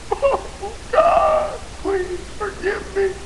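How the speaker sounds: high, wailing cry for mercy; anguished plea. Acted. Son in hell begging God, anguished plea. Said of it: "you recognize a voice".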